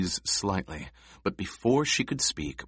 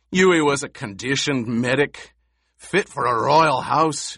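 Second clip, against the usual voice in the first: higher and louder angry snarl; 6 semitones higher, 6 dB louder than usual. A narrator's reading. Gurney snarling his disbelief to Paul, angry snarl. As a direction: spit the words in contempt. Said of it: "Gurney snarled".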